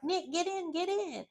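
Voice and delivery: high, urgent call from the car; urgent getaway call. Retold or imitated. Alandria calling Nick from the car, high urgent call. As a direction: hurry him into the getaway car. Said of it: "she's just like".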